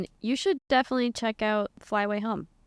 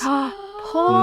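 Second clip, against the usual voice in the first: shocked gasp; 10 semitones higher, 6 dB louder than usual. Real, not acted. Shocked at cruel goose plan.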